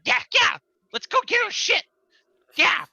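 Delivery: very high, loud little goblin cheer; a tiny monster egging the others on; excited squeal from the back of the cave; squeaky goblin. Acted.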